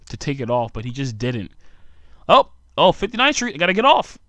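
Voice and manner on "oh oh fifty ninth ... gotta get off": high, hammed-up sudden realization; mock flustered passenger. Imagined culprit hurrying off the train, mock flustered voice. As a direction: pipe up as if nearly missing your stop.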